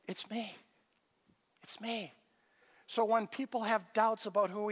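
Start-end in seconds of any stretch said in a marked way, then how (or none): 0.1-2.1 much softer, a gentle reassurance; hushed and tender, Jesus to frightened friends; soft tender It's me. Acted. Jesus shows his scars.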